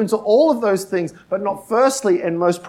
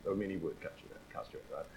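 barely audible whisper, much softer; whispered aside; 11 semitones lower, 24 dB quieter, faster than usual. Acted. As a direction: drop to a breathy whisper as a throwaway aside. Preacher mimics the opposite meaning.

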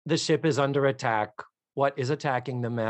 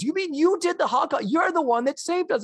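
higher, amazed Kermit voice; Kermit imitation. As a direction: squeak it like an astonished frog. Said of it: "he goes".

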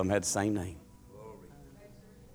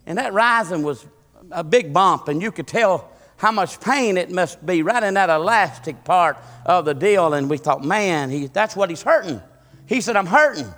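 loud, high, climbing sermon story; fervent revival preacher telling the tale; preaching at full force; 8 semitones higher, 8 dB louder than usual. Acted. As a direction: preach it out, rising as you go.

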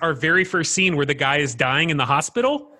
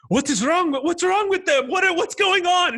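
high panicked voice; 13 semitones higher than usual. Retold or imitated. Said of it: "Amitabh Bachchan's character is like".

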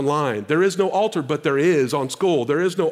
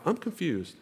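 quiet aside to wife; 9 dB quieter than usual. Retold or imitated. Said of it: "I said to my wife".

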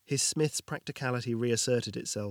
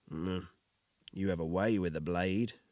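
low gruff voice=67; 5 semitones lower than usual. Acted. Victor's compliment.

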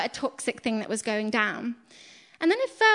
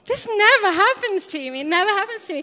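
amazed; 6 semitones higher, 7 dB louder than usual. Retold or imitated. Retelling her amazed reply.

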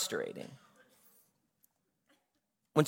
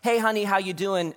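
sweetly imitated, higher-pitched greeting; 6 semitones higher than usual. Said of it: "Mary is so sweet, and she's like".

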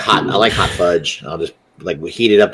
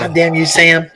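mock angry wife; 9 semitones higher than usual. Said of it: "She'd be like".

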